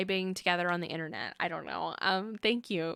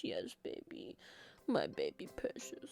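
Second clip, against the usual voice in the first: hushed tender whisper, much lower and softer; whisper to dog; 11 dB quieter than usual. Real, not acted. Cooing to her old dog.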